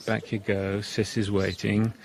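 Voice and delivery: ordinary talk, nothing marked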